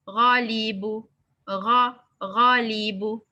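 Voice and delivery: normal talking voice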